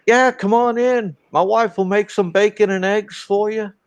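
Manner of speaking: high, mock Southern host welcoming strangers at night; friendly country storekeeper. Same storekeeper invites them in.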